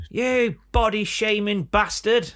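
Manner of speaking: high, slow mock outrage. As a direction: heckle like an offended crowd. Mock heckle at bingo caller.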